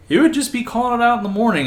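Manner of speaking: high, loud imitated voice crack; cracking young voice. Young drill cadet calling out exercises, cracking voice. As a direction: squeak it out like a breaking voice. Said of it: "he still had a cracking voice".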